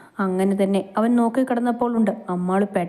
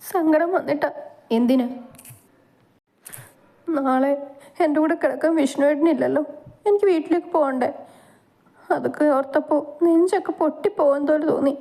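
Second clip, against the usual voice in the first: tearful; 6 semitones higher than usual. Acted. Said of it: "വാവിട്ട് കരഞ്ഞു പോയിരുന്നു".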